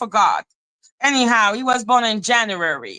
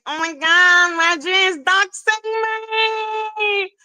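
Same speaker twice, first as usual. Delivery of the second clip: high, whiny mock of a crying troll; mock crybaby whine; 8 semitones higher, slower than usual. Mocking the troll as he cries to others, high and whiny. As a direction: whine it like a baby tattling. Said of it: "go and cry and say".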